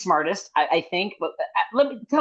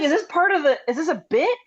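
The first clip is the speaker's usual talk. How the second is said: retold disbelief; 6 semitones higher than usual. Retells her disbelief at the seating.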